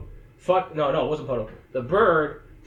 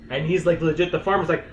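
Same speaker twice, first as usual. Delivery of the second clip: usual speaking voice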